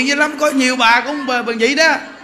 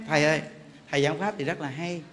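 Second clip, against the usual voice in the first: much lower and softer, fast sweet request; sweet soft plea; 8 semitones lower, 7 dB quieter, faster than usual. Retold or imitated. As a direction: coax the teacher gently and quickly, almost murmuring. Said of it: "Cái ngọt, biết ghê".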